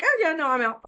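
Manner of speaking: high, breezy brush-off; mock dismissive querent. Voicing a querent breezily bowing out, high and flippant. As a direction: toss it off like you're already leaving.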